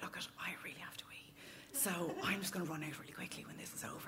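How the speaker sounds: hushed, hurried whisper; urgent whisper so nobody else hears; cinema whisper. Retold or imitated. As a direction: lean in and whisper it quickly. Leans over to date in cinema.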